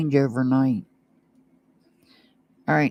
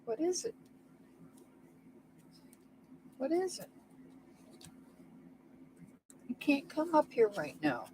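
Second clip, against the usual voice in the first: high, distant call to another room; yelling across the room; 10 semitones higher, 18 dB quieter than usual. Real, not acted. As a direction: shout from far off the mic. Calling to someone off-mic.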